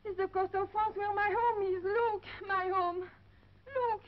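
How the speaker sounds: nearly sobbing at the sight of home; tearful longing. Acted. Homesick lady seeing her French coast, tearful cry. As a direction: voice breaking into a cry, loud and high.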